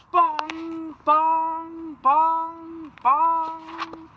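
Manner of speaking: high, loud ringing bongs like a church bell. Acted. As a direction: ring out each bong.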